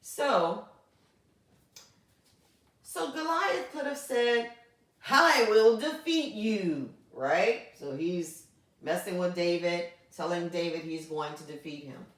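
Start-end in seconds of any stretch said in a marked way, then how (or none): 5.1-6.9 mock taunt. Teacher voicing Goliath's taunt.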